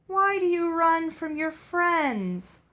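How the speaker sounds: slow, high, honeyed and luring; slow sweet ogre calling after the coach. A narrator's reading. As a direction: coax them back like a siren. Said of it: "It was the sweetest voice I had ever heard".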